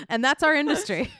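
ordinary delivery

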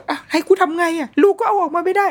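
high, panicked plea; mock exasperated complaint; flustered high-pitched protest; frantic. Frantic parent in stuck traffic.

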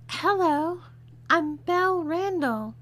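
a careful, high feminine introduction; breathier girly voice. A narrator's reading. Disguised man retrying his introduction after coaching, breathier girly voice. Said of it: "keep a breath you're less nasal".

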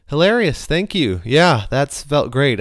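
exaggerated sarcastic gratitude; sarcastic thanks. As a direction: pretend to find the joke hilarious. Sarcastic reply to costly joke.

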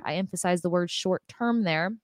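ordinary delivery

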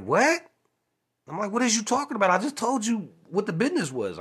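incredulous outburst. Retold or imitated. Retelling his answer to the manager, a high incredulous outburst. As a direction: cry out in disbelief, as if he's lost his mind.

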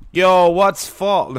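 drawn-out, bungled attempt at an Australian accent; botched Aussie accent. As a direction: try an Aussie voice and miss. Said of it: "that's the wrong accent".

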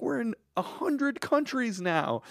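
high, drawn-out mock-thrilled report; excited news. Danny reporting listener countries, imitated with excitement. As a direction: imitate someone proudly breaking big news, high and slow. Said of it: "Or he'd be like".

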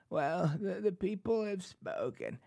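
soft, halting mock impression of a politician; Nancy Pelosi. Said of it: "I don't do a great Pelosi".

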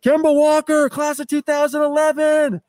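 high-pitched shouted heckle, stretched out at the end; imitated fan's scream. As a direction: holler like an excited fan from the balcony. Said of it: "he just screamed out".

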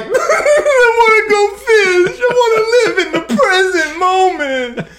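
laugh-crying. Retold or imitated. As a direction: gush like overwhelmed fan. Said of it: "I'm like".

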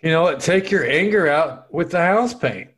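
acted anger. Painter fed up with partner, loud exasperated order. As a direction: push each word out high and loud.

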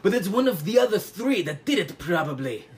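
clumsy mock French accent; badly faked French detective accent; Poirot. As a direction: lay out the case in faux French. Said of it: "And he's like".

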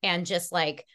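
ordinary delivery

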